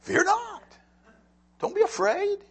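high, ringing Fear not and Don't be afraid; voice of God. Retold or imitated. God telling worried people not to fear, high ringing voice. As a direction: call it out like God reassuring. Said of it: "I think God's trying to tell us something".

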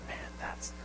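whispered, awed aside; acting out a quiet, breathed marvel; hushed quote. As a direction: drop to a near-voiceless whisper. Voices Jesus marvelling at woman.

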